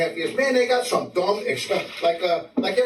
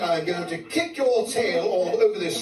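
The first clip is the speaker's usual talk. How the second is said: slow, mock-threatening British inmate; tough-guy British accent; 3 semitones higher, slower than usual. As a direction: try to sound tough in a refined British accent. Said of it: "trying to sound tough in jail".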